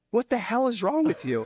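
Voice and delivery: a high, startled coach barking at his player; incredulous coach. Retold or imitated. Head coach startled at the player's bloody chin, incredulous. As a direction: play the stunned coach.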